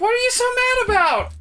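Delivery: high whine, stress you; mocking Vanessa. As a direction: whine it at him. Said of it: "mocks Vanessa".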